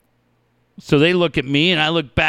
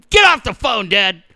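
mock scolding son; 6 semitones higher, 4 dB louder than usual. Son acted interrupting dad's call.

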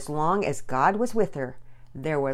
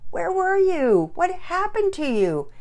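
high, loud, drawn-out questions; urgent questioning; 12 semitones higher, 6 dB louder, slower than usual. Acted. Father questioning his found daughter, high and urgent. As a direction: blurt it out high and stretched. Relieved reunion questions pour out.

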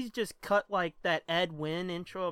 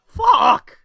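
frustrated shout; 16 dB louder than usual. Real, not acted. Frustrated outburst.

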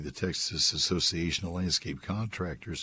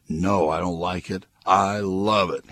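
retold gushing reply, leaning hard on "love"; emphatic punchline; 6 dB louder than usual.

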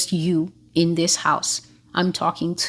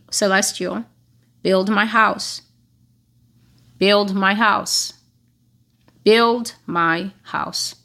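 clear warning tone; slower than usual. Retold or imitated. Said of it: "in a clear and warning tone".

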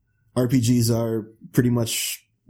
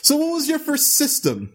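high silly voice. Pretend. Host in odd high voice.

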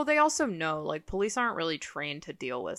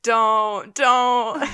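pleading don't; 4 semitones higher, 9 dB louder than usual. Retold or imitated.

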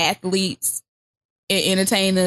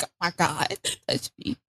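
hushed, whispered pleading; breathy mock plea; 3 semitones lower, 9 dB quieter than usual. Wife in bed whispering to old husband, soft plea. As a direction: whisper it like a bored wife begging. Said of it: "she is just like".

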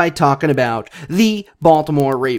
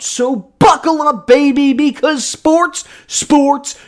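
high build, drawn-out final sports starts now; slow it down and land the title; hype announcer; 8 semitones higher, much slower than usual. Acted. Hype countdown to show.

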